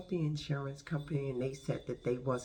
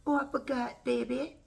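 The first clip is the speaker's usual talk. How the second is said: high, put-on Jamaican accent; mock Jamaican accent; 10 semitones higher, 4 dB louder than usual. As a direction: play the fence guy in a joking Jamaican accent. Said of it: "that's my Jamaican".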